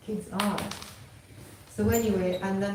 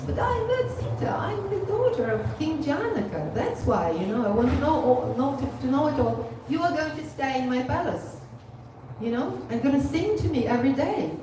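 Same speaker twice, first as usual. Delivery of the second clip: put-on high, louder, emphatic child princess; raised pitch, excited and commanding retold child; insistent little princess; 7 semitones higher, 5 dB louder than usual. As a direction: lay down the law like a small royal. Child princess insists parrots stay.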